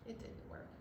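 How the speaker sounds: hushed whisper. Acted. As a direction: whisper it sadly. Sad picture book recap.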